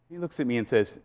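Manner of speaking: ordinary delivery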